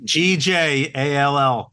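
G J A L L slowly, letter by letter; spelling out. Shown as a demonstration. Owner spelling the horn's name for the host, slow letter by letter. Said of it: "So that's how you s".

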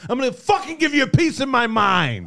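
put-on belligerent drunk.

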